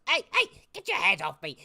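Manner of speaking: put-on old halfling yelping to be let go; indignant protest. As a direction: shout at the one grabbing you. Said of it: "He goes".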